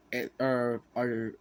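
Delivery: tripping over words, stuttering. Real, not acted. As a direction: stumble and stutter, then restart. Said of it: "I'm stuttering".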